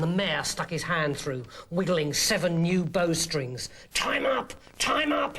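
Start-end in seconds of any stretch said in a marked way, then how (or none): none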